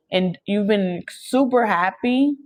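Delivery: usual speaking voice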